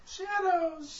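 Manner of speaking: high, held-out mock eerie call; playful call-out. Teammate chimes in on the group's name, playful high call-out.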